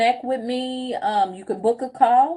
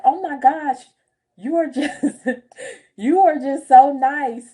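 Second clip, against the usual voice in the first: delighted clerks; 5 semitones higher than usual. Retold or imitated. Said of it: "they were like".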